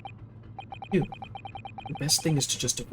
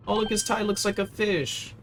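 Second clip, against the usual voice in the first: usual speaking voice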